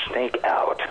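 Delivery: whispered Snake sign-off. Pretend. Signs off as Snake.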